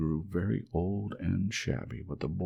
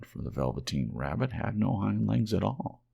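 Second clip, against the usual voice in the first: usual speaking voice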